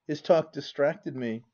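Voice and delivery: ordinary delivery, nothing marked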